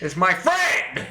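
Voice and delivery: high, drawn-out protest; protesting yell. Acted. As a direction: cry it out in your friend's defence. Continues yelling at the clay creature.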